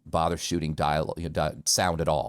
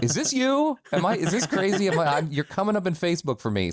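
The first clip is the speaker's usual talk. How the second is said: higher, quick, mock amazed friends asking; surprised friends; 10 semitones higher than usual. Friends messaging him in disbelief, high mock surprise. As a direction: play a friend gasping at a surprise sighting. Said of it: "are like".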